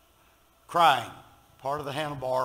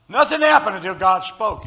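preaching; 6 semitones higher, 11 dB louder than usual. Acted. Preaching at full force.

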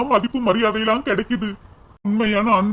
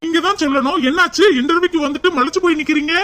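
higher-pitched, incredulous questioning; puzzled, raised-pitch reproach; baffled challenge; 5 semitones higher than usual. Acted. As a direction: press the dazed applicant for an answer. Interviewer challenges the dazed applicant.

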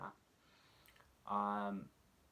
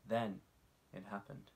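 ordinary delivery

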